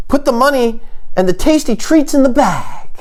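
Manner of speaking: high-pitched comic stick-up demand; mock bank robber. Would-be robber demanding cash and treats, hammed-up command. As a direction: play a silly robber, pitched well up. Acts himself robbing a bank.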